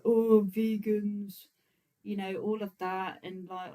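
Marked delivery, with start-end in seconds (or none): 0.0-1.5 slow, drawn-out eye-roll at vegans; mocking sneer. Streamer mimics commenters scoffing at vegans, slow mocking sneer. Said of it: "people like".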